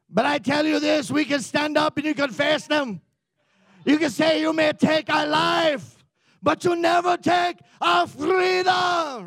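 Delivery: high, shouted battle speech in put-on accent; William Wallace. Joshua psyching up the army, hammed-up William Wallace shout. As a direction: build to a long, roared final word. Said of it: "Joshua turns into William Wallace".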